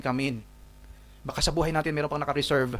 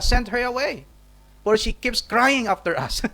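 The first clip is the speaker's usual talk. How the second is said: higher, urgent plea to be rid of her; irritated disciples; 8 semitones higher, 4 dB louder, slower than usual. Acted. Disciples urging Jesus to send the woman away, annoyed. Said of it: "Ang sabi po nila".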